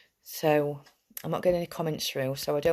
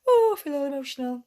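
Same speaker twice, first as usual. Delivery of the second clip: higher, louder, fast, on the edge of tears; choked-up emotion; 10 semitones higher, 5 dB louder, faster than usual. Real, not acted. Group leader overwhelmed saying goodbye, choked up and teary. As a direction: let the feelings crack through as you rush it. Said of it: "before I start crying".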